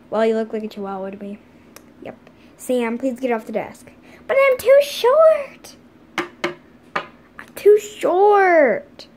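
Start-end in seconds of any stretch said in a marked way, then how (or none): 4.3-9.1 high, loud, drawn-out whine; whiny child. Pretend. Short student pleading with the teacher, high whine. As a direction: whine it like a little kid.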